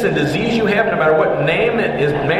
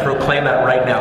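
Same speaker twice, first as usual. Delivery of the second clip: ordinary delivery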